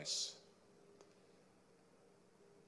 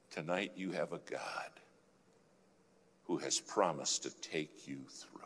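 soft unvoiced whisper, softer; 3 semitones lower, 7 dB quieter than usual. Real, not acted. Preacher closing to the congregation, whispered. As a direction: whisper the promise close.

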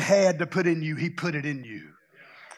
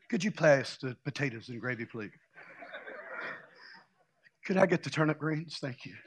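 low, hushed polite request; imitated quiet table; 5 semitones lower, 10 dB quieter than usual. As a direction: murmur it like a very subdued dinner guest. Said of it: "nobody hardly talked".

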